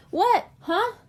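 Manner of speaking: high, jolted awake; groggy surprise on waking; startled sleepily. A narrator's reading. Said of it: "Shelley replied sleepily".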